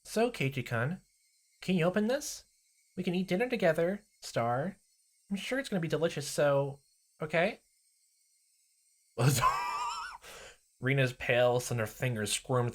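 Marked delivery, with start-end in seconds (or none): 0.0-7.6 put-on high sweet coaxing girl voice; Rena, sweet. Rena coaxing Keiichi through the door, high and sweet. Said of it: "she phased through the door". 9.2-10.4 very high eerie cackle; a wild unhinged giggle; creepy shrieking laugh. Acted. Rena laughing at the door, high unhinged cackle.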